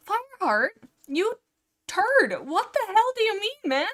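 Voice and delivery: high, loud exasperated scolding; mock outrage. Reader scolding Fireheart over the book, mock-outraged. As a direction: tell off a character in mock fury, pitch leaping. Scolds the book's hero after reading.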